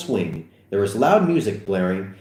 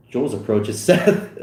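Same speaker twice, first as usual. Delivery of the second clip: usual speaking voice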